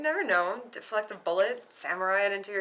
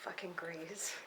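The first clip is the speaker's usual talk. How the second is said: whispered grumble; 5 semitones lower, 14 dB quieter, slower than usual. Acted. Grumbled under breath.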